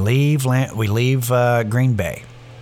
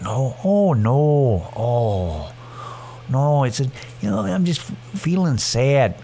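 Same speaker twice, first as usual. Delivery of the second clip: mock Fargo-character accent; drawn-out, mournful Fargo accent; slower than usual. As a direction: imitate a sad dog owner with long Fargo vowels. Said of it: "It's like total Fargo characters".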